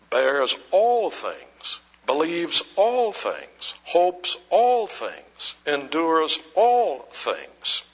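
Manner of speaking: slowly reading out each phrase; slow, deliberate scripture reading; slow recitation. Real, not acted. Slow measured reading of Bible phrase.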